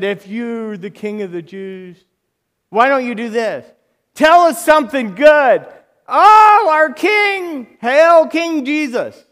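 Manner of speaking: high, loud, sneering taunt; mocking crowd. Mockers taunt Jesus on the cross, high loud sneer. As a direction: jeer at him, mock praise.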